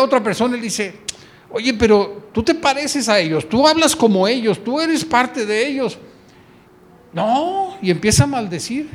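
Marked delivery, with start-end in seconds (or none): 1.5-6.0 accusing bystander. Acted. Second accuser presses Peter. 7.1-7.8 a high, startled no; sharp denial. Acted. Peter cuts off the accuser with a high no.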